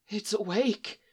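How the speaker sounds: frightened whisper. A narrator's reading. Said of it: "one of them whispers".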